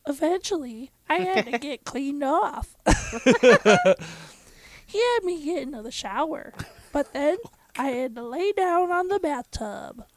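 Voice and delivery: put-on girl's voice. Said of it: "Oh, I forgot the girl's voice".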